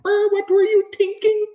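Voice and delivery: very high mock coach voice scolding a player; mimicking the same coach. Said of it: "He sounded like a moron".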